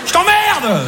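mock heckler. Audience member yelling back.

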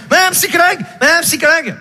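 put-on a boy calling for his therapist. Enfant appelle la psy. (enfant qui appelle, aigu et fort)

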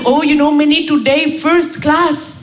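put-on blissful Indian-accented colleague, drawn-out ending; Raj. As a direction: gush slowly in an Indian accent. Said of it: "il arrive".

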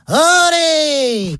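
long, high, sustained olé cry; bullfighter's olé. Acted. Housewife dodging the falling child like a bullfighter, drawn-out olé. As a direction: sing out the olé like a matador at a bullfight. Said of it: "la femme pivote et s'exclame".

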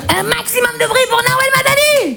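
big rising show-intro call; hype announcer. Acted. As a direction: whip up the crowd with a high announcer's cry. Hyping the crowd for her entrance.

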